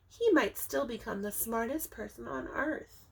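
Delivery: ordinary delivery, nothing marked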